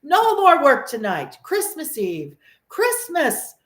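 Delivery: rally the workers merrily; jovial cry. A narrator's reading. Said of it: "cried old Fezziwig".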